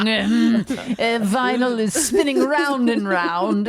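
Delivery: lightly hammed-up fancy host, savoring each word; a touch of airy, slow luxury; mock posh host.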